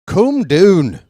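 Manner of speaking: loud, high Irish lady's "coom doon"; Irish accent. Shown as a demonstration. Commentator mimics Irish movie lady telling a guy off, Irish accent. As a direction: cry it out in a mock Irish accent. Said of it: "That's my best Irish accent".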